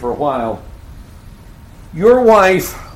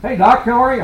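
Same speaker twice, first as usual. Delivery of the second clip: cheery phone greeting; 9 semitones higher than usual. Retold or imitated.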